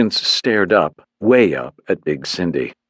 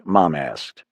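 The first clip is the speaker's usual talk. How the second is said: plain reading voice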